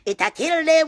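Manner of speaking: high loud gloating cry; taunting shout. Real, not acted. Repeated taunt.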